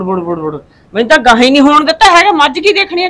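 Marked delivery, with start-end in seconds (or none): 0.0-0.6 low, soft mimicked grumble; mock grumbling. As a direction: mumble bud bud bud like a sulky man. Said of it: "ਉਵੇਂ ਹੀ ਬੋਲ, ਬੁੜ". (ਹੌਲੀ ਬੁੜ ਬੁੜ ਦੀ ਨਕਲ)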